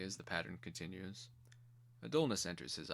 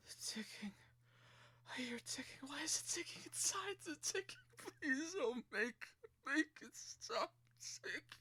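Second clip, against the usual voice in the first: much higher, whispered panicked pleading that breaks into crying; a tortured man begging the ticking to stop; terrified pleading; 4 dB quieter than usual. Acted. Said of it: "His moaning rises to a hoarse scream".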